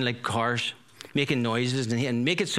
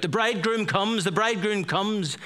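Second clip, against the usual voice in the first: high ringing call, higher; 8 semitones higher than usual. Retold or imitated. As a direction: cry it out like a herald announcing. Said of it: "the shout goes out".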